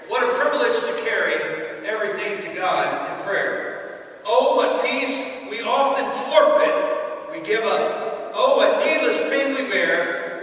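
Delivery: loud, fervent reading of hymn words; impassioned recitation of What a Friend We Have in Jesus; poem recital. Real, not acted. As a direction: declaim the hymn like a sermon.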